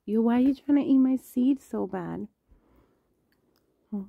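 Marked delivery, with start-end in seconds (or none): 0.0-2.3 higher and louder, teasing the pet; high voiced scolding. Real, not acted. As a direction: call out to the pet, high and loud. Scolding a pet over seed.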